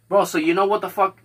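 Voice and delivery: usual speaking voice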